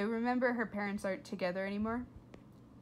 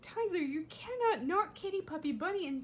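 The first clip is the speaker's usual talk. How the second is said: calling out; 7 semitones higher, 4 dB louder than usual. Real, not acted. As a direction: call him sharply to stop. Teacher calls out to pushing child.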